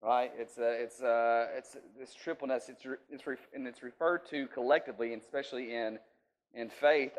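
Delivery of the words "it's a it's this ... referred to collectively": ordinary talk, nothing marked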